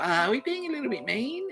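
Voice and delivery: high-pitched squeamish self-imitation; mock hesitant doubt. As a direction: pipe up high, gently mocking your own qualms. Voicing own earlier hesitant doubts.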